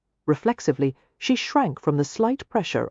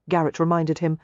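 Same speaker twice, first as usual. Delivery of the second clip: ordinary delivery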